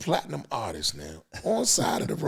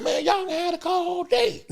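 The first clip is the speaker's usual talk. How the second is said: mild driver voice. Retold or imitated. Driver bargaining, quoted.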